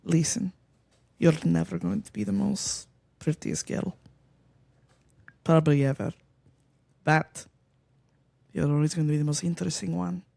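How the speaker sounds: put-on mom's line in a light Russian accent; a hint of a stern Russian mother; lightly accented Russian mom voice. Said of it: "You gotta do it in the Russian voice".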